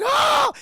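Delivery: high, loud retold shriek of panic; a sudden high yelp; mock scream. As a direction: let out a hammed-up scream. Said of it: "And she's like".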